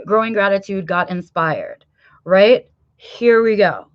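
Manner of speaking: normal talking voice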